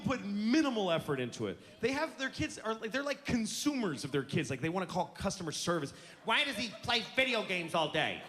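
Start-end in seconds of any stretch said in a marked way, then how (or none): 6.2-8.2 a hint of a whiny, clueless parent. Parent calling customer service, a lightly whiny complaint.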